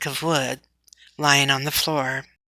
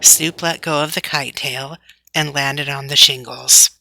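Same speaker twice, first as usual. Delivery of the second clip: ordinary delivery, nothing marked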